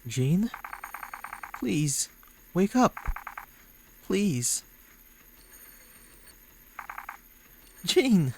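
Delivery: high, anxious calls to wake someone; urgent pleading. Acted. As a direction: beg someone unconscious to wake, ending in a cry. Pleads with unconscious Jane to wake.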